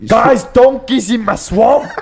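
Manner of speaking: Shrek. Acted. Telling friends of swamp intruder, ogre impression. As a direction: announce the trespasser like a cartoon ogre. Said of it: "you can do a Shrek impression".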